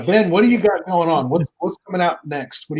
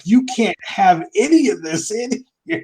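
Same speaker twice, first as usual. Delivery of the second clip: health inspector voice; 7 semitones higher than usual. Retold or imitated. Health inspector quoted in story.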